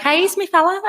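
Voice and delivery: high, loud mock-possessive call; teasing claim. Sister pretending her brother is her boyfriend, playful high claim. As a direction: stake a jokey claim on him, high and loud. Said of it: "I was like".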